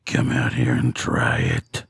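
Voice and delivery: very low, slow demonic taunt. A narrator's reading. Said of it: "it taunted with a demonic voice".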